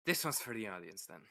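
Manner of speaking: usual speaking voice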